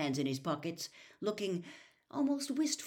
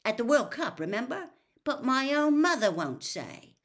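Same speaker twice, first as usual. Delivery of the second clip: a touch of indignant grumbling; 7 semitones higher, 7 dB louder than usual. Acted. Ron complains.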